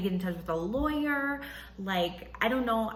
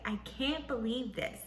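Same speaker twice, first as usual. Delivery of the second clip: normal talking voice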